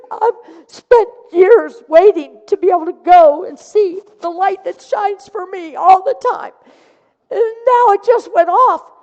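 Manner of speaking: high, loud crying lament; tearful wail. Retold or imitated. Woman crying to student about the lost light, loud and high. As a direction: let the voice break with grief.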